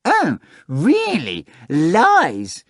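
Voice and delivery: high, slow, indignant cat exclaiming; a cat feigning outrage. Acted. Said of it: "exclaimed the cat".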